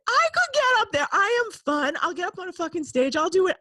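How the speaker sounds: very high, loud indignant self-promotion; worked-up, high-pitched bragging; jealous boasting. Retold or imitated. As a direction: insist you could do it just as well. Acts out jealous boasting to herself.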